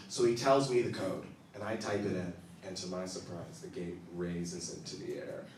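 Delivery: ordinary talk, nothing marked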